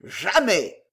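melodramatic exclamation with a falling end; theatrical. A narrator's reading. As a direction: refuse like a stage hero. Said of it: "s'écria monsieur Jingle d'un air théâtral". (exclamation théâtrale)

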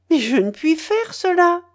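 high, loud wounded outcry; loud hurt exclamation; hurt cry. A narrator's reading. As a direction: cry out, stung. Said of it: "s'écria la jeune fille blessée".

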